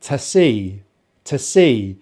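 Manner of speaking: "to see" as joined "tuh-SEE"; short weak "to" leading into see; weak form linked. Shown as a demonstration. Teacher drilling the phrase, linked schwa pronunciation. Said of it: "they're kind of joining together".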